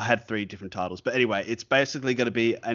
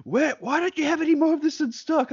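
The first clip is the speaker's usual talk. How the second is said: very high-pitched mock customer demanding an answer; screaming lady. Customer to toy store clerk about stock, high mock screech. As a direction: imitate an irate woman in a high squeal. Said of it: "I had a screaming lady being like".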